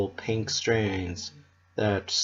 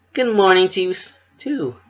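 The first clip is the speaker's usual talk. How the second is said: chipper; 10 semitones higher, 4 dB louder than usual. Acted. Pinkie's bright greeting.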